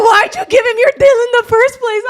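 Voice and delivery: high, loud, fast scolding through laughs; mock scolding. Mom scolding her daughter, loud and fast. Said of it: "She's like".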